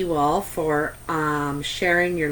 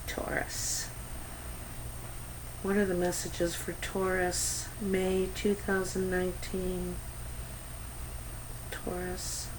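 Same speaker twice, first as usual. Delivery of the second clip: whispering; 12 dB quieter than usual. Real, not acted. Quietly asking the cards.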